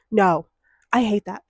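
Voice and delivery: normal talking voice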